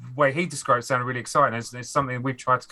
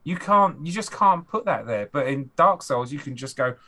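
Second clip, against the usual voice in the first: usual speaking voice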